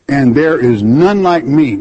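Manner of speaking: "none" hit hard and falling; emphatic. Shown as a demonstration. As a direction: press down on "none like me". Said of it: "for emphasis".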